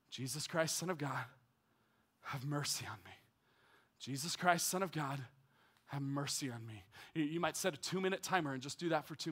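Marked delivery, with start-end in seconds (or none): 0.1-6.8 quiet meditative prayer; breath prayer demo. Shown as a demonstration. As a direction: breathe it in and out softly. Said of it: "Here's how it works".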